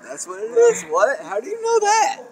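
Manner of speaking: high, startled questions; surprised. Real, not acted. Friend learns it's a poison headcrab, surprised.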